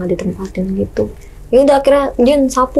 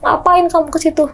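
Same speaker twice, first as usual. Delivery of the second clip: retold sharp, high scolding; father snapping; 7 semitones higher than usual. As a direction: scold the girl like an angry father. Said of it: "dibentak sama bokap gua". (bentakan ayah yang ditirukan, nada tinggi)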